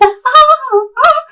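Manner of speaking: very high sung-out ah ah; high prancing squeal. Retold or imitated. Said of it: "I see her just".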